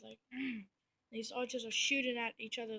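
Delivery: usual speaking voice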